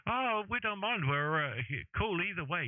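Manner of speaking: high-pitched caricature of a relaxed guy; mock high voice. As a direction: toss it off in a high, lazy put-on voice. Other guy voiced in retold show.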